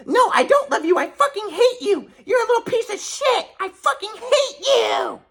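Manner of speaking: high-pitched mock screech of a furious mother; hateful mom. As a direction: imitate a mother spitting hatred, stretching the final words. Acting out mother rejecting his love.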